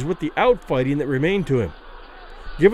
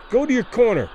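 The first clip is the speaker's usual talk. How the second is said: firm, raised-pitch order; authoritative; 6 semitones higher than usual. A narrator's reading. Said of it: "He spoke with authority".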